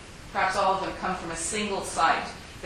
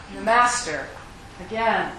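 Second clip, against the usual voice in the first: plain reading voice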